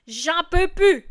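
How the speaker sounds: loud sung-like exclamation of despair; fed-up cry. Shown as a demonstration. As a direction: cry out that you can't take it. Lyric climax, desperate.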